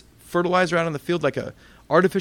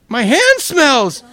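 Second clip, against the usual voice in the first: much higher, louder, slow shocked realization; dawning realization; 11 semitones higher, 9 dB louder, much slower than usual. Retold or imitated. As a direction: realize slowly it's your own hand. Discovering the smell is his hand.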